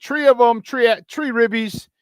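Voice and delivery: high, hammed-up Chicago accent, "tree" for three. Host reporting the ribbies to listeners, put-on Chicago accent. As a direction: stretch it out in a mock Chicago accent. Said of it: "In my Chicago accent".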